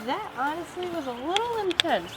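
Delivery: startled. Real, not acted. Fire flares up suddenly.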